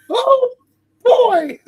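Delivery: very high squeaky cartoon mouse voice, slow. Friend mimics a cartoon mouse, very high squeaky voice.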